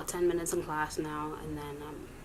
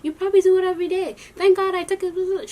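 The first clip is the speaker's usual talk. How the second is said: high, loud telling-off in someone else's voice; loud, high-pitched hammed-up scolding; mock scolding rant; 12 semitones higher, 10 dB louder than usual. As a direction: imitate a woman going off, pitched way up. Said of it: "She's going off".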